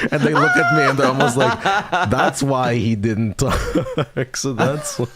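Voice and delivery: high, loud, laughing through the words; giggling while telling it. Real, not acted. Laughing while quoting staff.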